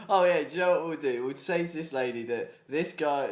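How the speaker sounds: loud, fast mocking voice; mock scheming neighbours. Friend imagines neighbours setting up the salesman, mock scheming. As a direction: gleefully voice the plotting neighbours.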